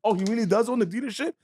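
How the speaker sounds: high, disbelieving; stunned realization. Retold or imitated. Skit maker realizes the guy owns the dealership, stunned. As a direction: it dawns on you, he's real.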